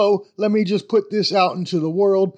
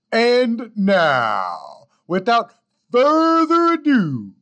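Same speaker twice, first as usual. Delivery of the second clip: high, slow announcer build; ring-announcer hype; announcer intro; 5 semitones higher, much slower than usual. Acted. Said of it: "ending outtake".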